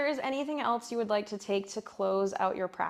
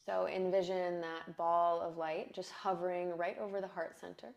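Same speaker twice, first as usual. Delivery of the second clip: guided meditation; 4 dB quieter than usual. Acted. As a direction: breathe the words out softly.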